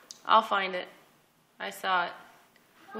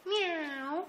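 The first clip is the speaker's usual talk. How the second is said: high, softer "Meow" like a kitten; soft kitty meow; 7 semitones higher, 7 dB quieter than usual. Pretend. Mother meows softly and high for her toddler. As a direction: mew it gently and high.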